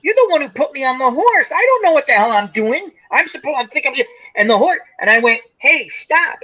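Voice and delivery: high-pitched mock panic; acting out a flustered rider arguing back; retold panicked protest. Retold protest to the yelling lady.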